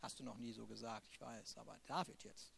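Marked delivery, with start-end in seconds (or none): none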